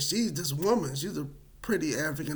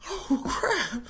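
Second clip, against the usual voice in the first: high pitched laughing oh crap; laughing dismay; 13 semitones higher than usual. Retold or imitated. Retelling his reaction to the news, laughing.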